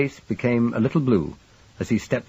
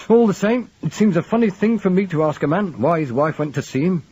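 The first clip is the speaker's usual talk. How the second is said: high, loud grudging complaint; protest, rattled and reluctant; 10 semitones higher, 4 dB louder than usual. A narrator's reading. Yeo grudging, exasperated.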